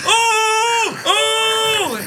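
very high, long mock wail; over-excited imitation. Mocking douchebags who hit a big card, over-excited. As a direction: stretch it into a hammed-up high screech. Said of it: "they get so excited".

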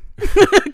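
short, high, loud laugh. Real, not acted. Teasing co-host who put someone on the spot, high loud laugh. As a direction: burst out laughing.

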